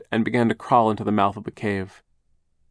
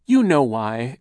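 crossly; 4 semitones higher, 7 dB louder, slower than usual. Acted. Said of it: "Aunt Josephine said crossly".

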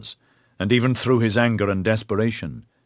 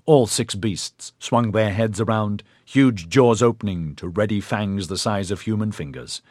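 ordinary delivery

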